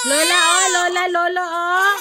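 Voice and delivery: loud high call for lola and lolo. Real, not acted. Parent calling grandparents over crying baby, loud call.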